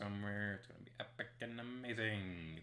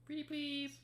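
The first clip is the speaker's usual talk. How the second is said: very high squeaky pleading; high cutesy begging. Pretend. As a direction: squeak it out sweetly. Begging again, higher.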